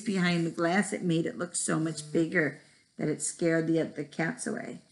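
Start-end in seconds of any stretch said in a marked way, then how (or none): none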